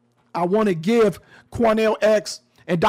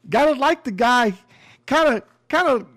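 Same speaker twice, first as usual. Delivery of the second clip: higher-pitched mock admiration; sarcastic; 4 semitones higher than usual. Real, not acted. Podcaster mockingly praising the sheriff, sarcastic. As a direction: lay on the sarcasm, voice lifted. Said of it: "I'm being sarcastic".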